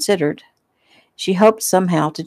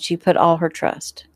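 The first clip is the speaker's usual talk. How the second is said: plain reading voice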